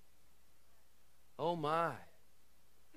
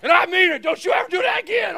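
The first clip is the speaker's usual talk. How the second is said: loud, high, hammed-up parent telling-off; exaggerated stern yell; mock scolding shout; 9 semitones higher, 15 dB louder, faster than usual. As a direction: play the fed-up parent, fast and shouted. Acted parent scolding a child.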